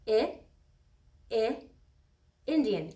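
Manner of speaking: short "I" sound repeated, then "Indian"; isolated short i sound. Shown as a demonstration. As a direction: stretch the short vowel "I, I" before "Indian". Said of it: "the same sound".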